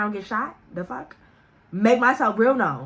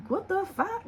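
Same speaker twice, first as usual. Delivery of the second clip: a higher, soft startled "What the fuck?"; 9 semitones higher, 6 dB quieter, slower than usual. Retold or imitated. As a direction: pitch it up in sudden alarm. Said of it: "I'm like".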